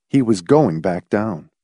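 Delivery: ordinary delivery, nothing marked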